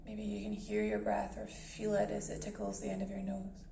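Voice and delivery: calm guided meditation voice with long pauses. Acted. Meditation host guiding listeners to breath and body, calm and measured. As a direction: ease listeners into stillness, unhurried and even.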